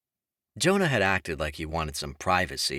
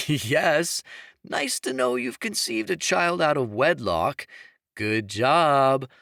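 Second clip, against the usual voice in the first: teasing; 5 semitones higher, 4 dB louder than usual. A narrator's reading. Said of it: "Jonah teased".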